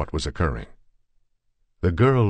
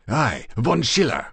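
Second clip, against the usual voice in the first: higher, fierce cry of the name; fierce exclamation; 8 semitones higher, 3 dB louder than usual. A narrator's reading. Demart to the guests about the German, fierce. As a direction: spit the name out as an accusation. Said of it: "exclaimed de Mart fiercely".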